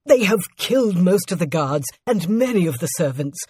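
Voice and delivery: stammering, climbing into high anguish; desperate servant crying out to his queen; panicked voice. A narrator's reading. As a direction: break the news in mounting panic. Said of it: "he said in a panicked voice".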